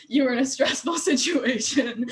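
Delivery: drawn out, bubbling with laughter; laughing. Real, not acted. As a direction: crack up while finishing the quote. Breaks into laughter while quoting.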